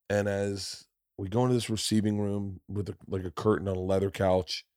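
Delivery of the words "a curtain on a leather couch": ordinary talk, nothing marked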